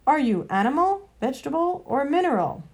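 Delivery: a lightly voiced brisk bulldog; businesslike bulldog. A narrator's reading. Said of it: "the bulldog in his businesslike way".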